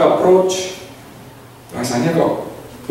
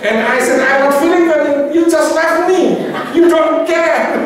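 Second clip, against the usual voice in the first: hurt complaint, pitched higher; upset friend; 12 semitones higher, slower than usual. Retold or imitated. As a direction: act the annoyed friend. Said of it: "Itu kesel orangnya itu". (teman yang kesal, nada tinggi)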